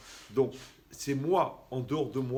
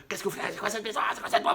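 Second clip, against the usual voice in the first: mock angry yell; 8 semitones higher, 6 dB louder, faster than usual. Imitating angry tired parent yelling.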